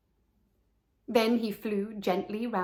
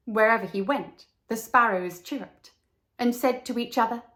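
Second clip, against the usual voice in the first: plain reading voice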